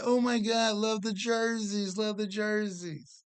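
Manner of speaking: high-pitched, drawn-out imitation of adoring strangers; gushing admirers. Strangers admiring the family's matching jerseys, mock gushing. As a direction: coo it like passers-by fawning over an outfit.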